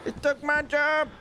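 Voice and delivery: 'They took our jobs' South Park style. Retold or imitated. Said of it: "the goddamn fucking South Park people".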